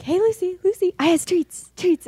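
high, sing-out call to a pet; coaxing dog talk. Retold or imitated. Owner offers caged dog treats, high coaxing pet talk. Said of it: "I'm like".

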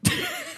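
high squealing laugh; a sudden high squeal of laughter; burst of laughter. Real, not acted. Laughs at his own slip.

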